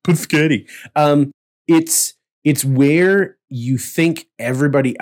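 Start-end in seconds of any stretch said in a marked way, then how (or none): none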